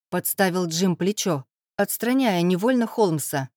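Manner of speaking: usual speaking voice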